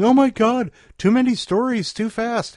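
high, loud mock overwhelmed listener; mock listener panic. Host imitating listeners overwhelmed by stories. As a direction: play the swamped listener. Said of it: "I'm sure you're going".

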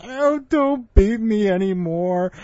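high, slow, whimpering plea; beaten gorilla. Retold or imitated. Smaller gorilla begs the bigger one, high whimper. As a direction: cower and beg. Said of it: "the eight hundred pound gorilla goes".

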